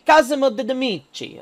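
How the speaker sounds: hammy Italian accent on the name. Shown as a demonstration. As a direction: say the name like a bad Italian impression. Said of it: "to use my terrible Italian accent for a second".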